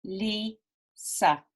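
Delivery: "Lisa" in two separate beats, Li, pause, sa; teacher sounding out "Lisa" part by part; split into syllables. Shown as a demonstration. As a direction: break "Lisa" slowly after the first syllable. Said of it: "Lisa has two syllables".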